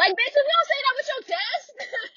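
very high-pitched exasperated outburst; outraged retort. Acted. Telling the whisperer to speak up, high-pitched exasperation. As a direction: squeal it in mock outrage.

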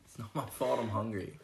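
talking while eating a burrito; mouth full. Real, not acted. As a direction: speak through a mouthful, laughing. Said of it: "I'm eating a burrito".

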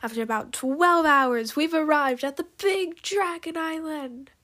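showy announcer delivery; announcing. A narrator's reading. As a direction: announce the arrival grandly. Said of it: "she announced".

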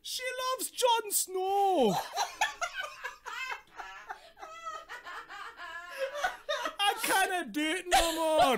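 high, drawn-out Scottish wail; Scottish accent. Acted. Playing lovelorn Icarus for laughs, drawn-out Scottish wail. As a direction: stretch it into a heartbroken mock-Scottish cry. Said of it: "It's the only Scottish accent I've got".

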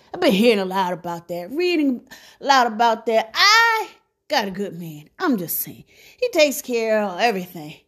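ordinary delivery

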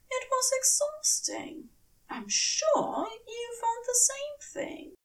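the tired angel complaining in a high voice; Aziraphale voice. Acted. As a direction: lift way up into the weary angel, ending in a whisper. Narrator voices Aziraphale throughout.

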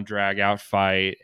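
normal talking voice

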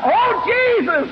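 high, drawn-out plea for mercy; crying out. Acted. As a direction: blind beggar calling out after a passer-by, high and slow. Acts blind beggar calling out to Jesus.

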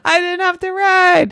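high, loud triumphant cry; gleeful shout. Acted. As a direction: crow with relief like a kid. Daphne celebrates not riding.

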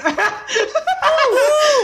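high falsetto hee-hee squeal; [name] squeal. Retold or imitated. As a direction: let out a high pop star yelp. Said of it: "aquele gritinho do [name]".

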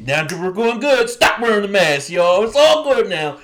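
loud, high mock proclamation; mock announcement. Host mocking governors ending mask rules, loudly hammed-up. Acts out officials' carefree announcement.

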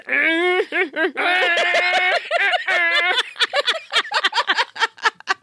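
long high laughter with shouting; long laughing fit. Real, not acted. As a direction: laugh hard, almost crying. Laughing after scary moment.

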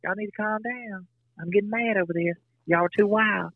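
high, soft, unconvincing mock-scold; imitating wife. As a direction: play the wife scolding in a high, soft voice that doesn't sound mad at all. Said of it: "this is the way she'll say it".

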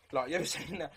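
a nervous laugh breaks into the question; half-laughing through a dark joke. Real, not acted. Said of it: "I'm making a little joke about it".

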